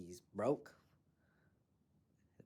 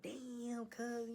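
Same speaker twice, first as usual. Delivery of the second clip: tag withheld; 14 semitones higher than usual. Retold or imitated. Retelling own shock at the stabbing.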